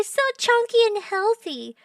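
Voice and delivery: high-pitched coo; gushing text voice. Shown as a demonstration. Streamer quoting own text about the baby, cooing high. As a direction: say it in a high gush. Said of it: "you gotta read it like this".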